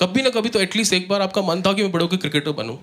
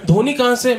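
higher, insistent friend's voice; friend quizzing; 6 semitones higher, 3 dB louder than usual. Retold or imitated. Comic quoting his Meerut friend's cricket theory, higher quizzing voice. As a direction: pitch up and press the question like she's caught you out. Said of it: "उसने मेरे को गिना भी दिया".

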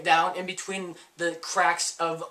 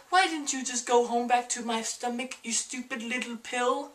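higher mock scold to the pill; 7 semitones higher, 4 dB quieter than usual. [name] scolds the coughed-up pill in a higher mock voice. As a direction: talk down to the pill. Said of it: "I'm like".

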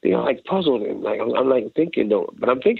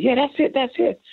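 much higher, excited cry; delighted approval; 13 semitones higher than usual. Retold or imitated. Producer over talkback, excited approval. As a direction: cheer him on, thrilled.

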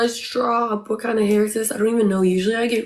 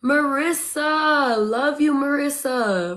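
loud, higher, drawn-out name; excited shout-out; 4 semitones higher, 5 dB louder, slower than usual. Real, not acted. Streamer hailing a supporter mid-battle, excited shout-out. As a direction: call out with delighted affection. Calling out thanks to gifter.

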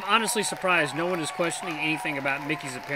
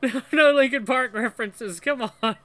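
amused protest; 7 semitones higher, 7 dB louder than usual. Real, not acted. Protests at the song references.

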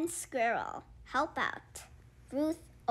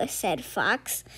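plain reading voice

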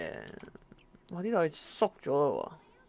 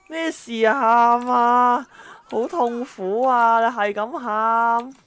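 higher, louder and very slow yelling; frustrated shout; 6 semitones higher, 9 dB louder, much slower than usual. Real, not acted. Player shouting at the endlessly crying baby, high and slow.

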